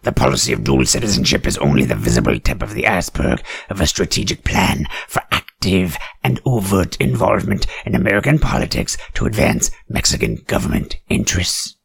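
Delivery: normal talking voice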